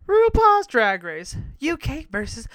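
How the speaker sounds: high, loud, slow announcing of RuPaul's Drag Race; announcer title. Shown as a demonstration. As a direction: belt the title out. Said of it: "Let's back this up".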